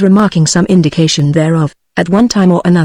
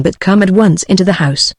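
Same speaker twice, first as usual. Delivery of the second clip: ordinary delivery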